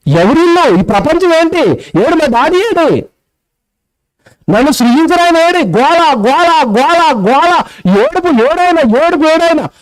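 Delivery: high-pitched mock wailing demands; panicked newborn. Preacher voices a newborn searching for its father, high panicked wail.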